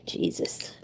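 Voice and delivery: breathy whisper of exasperation; whispered Jesus. Real, not acted. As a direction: whisper it under your breath. Muttered at the mess.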